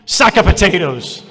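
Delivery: loud, clipped mock put-down; blunt bark. Seminar speaker shouting down the crying woman. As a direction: bark the phrase at her.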